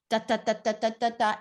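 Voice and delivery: mock choppy even syllables; fast staccato. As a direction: chop every syllable the same. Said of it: "It's really fast and staccato".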